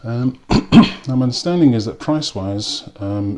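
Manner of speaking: normal talking voice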